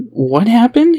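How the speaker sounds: high, loud, startled question; a father suddenly scared for his child; sudden worried cry; alarmed. A narrator's reading. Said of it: "seeming lost but greatly concerned".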